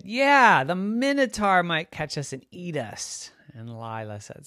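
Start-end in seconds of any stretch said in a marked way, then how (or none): 0.0-3.3 teasing brother. Acted. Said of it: "And Brother said".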